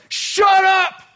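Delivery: very high, loud scream; yelling stewardess. Acted. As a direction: screech it to silence a passenger. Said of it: "she starts telling him".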